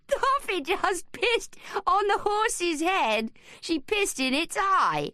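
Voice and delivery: put-on high, slow giggly boy voice; laughing. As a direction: laugh through it, delighted. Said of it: "Daniel let out a yelp of laughter".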